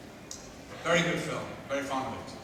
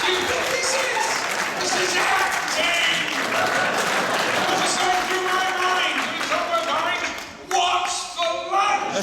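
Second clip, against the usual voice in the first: high, loud mocking rant; loud exasperated rant; 10 semitones higher, 6 dB louder than usual. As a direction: berate the fan for a silly question. Ranting at questioner about acting.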